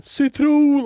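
high-pitched imitation of a scream; mock scream. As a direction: squeal it high without really shouting. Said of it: "Jag tror jag skriker citron".

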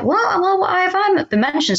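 high-pitched incredulous question; retold surprise. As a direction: replay your startled disbelief. Retells reaction to surprising news.

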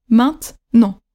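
"maintenant" split slowly into maint-nant; slow two-beat "maintenant", no middle e; two split syllables. Shown as a demonstration. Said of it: "Deux syllabes".

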